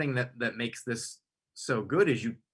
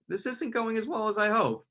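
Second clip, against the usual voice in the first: worried inner voice; 10 semitones higher than usual. Retold or imitated. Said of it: "you can probably tell".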